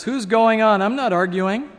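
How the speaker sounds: loud, high, indignant comeback; defensive retort. A narrator's reading. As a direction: argue back while denying you argue. Ghost argues back when calmed down.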